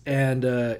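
usual speaking voice

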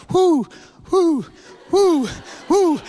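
high whooping "whoo"; short, high falling whoops; imitated leg sensation. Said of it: "it was going".

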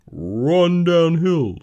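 long, loud, high mooing call; drawn-out cow holler; cows' suggestion. Acted. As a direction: moo the words out very slowly. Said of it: "suggested the cows".

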